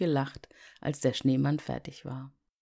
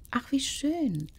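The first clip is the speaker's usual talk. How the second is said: put-on delighted little girl; 11 semitones higher than usual. Liese freut sich im Bett.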